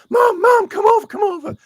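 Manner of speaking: very high, loud mock yelling; hammed-up imitation of an excitable son; excited calling to mom. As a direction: call mom over in a frantic put-on squeal. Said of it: "I'll do voices as well".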